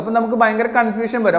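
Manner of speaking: usual speaking voice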